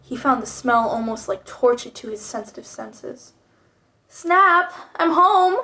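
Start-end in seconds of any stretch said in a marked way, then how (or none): 4.1-5.6 higher-pitched call into the house; raised, calling voice. A narrator's reading. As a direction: call out to announce an arrival. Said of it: "he called out".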